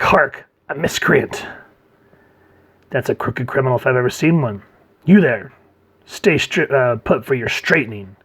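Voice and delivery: hammed-up, punchy old TV hero; Adam West. Joker playing Batman at a criminal, campy. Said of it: "very much the campy Adam West dialogue".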